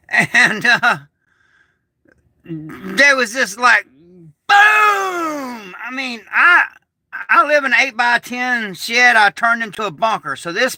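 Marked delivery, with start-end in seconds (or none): 3.9-5.8 a high, yelled boom; shouted explosion boom. Retold or imitated. Describing the blast to viewers, shouted boom. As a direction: yell the blast out.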